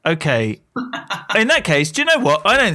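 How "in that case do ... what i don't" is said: excited. Real, not acted. Host excitedly announcing her guess.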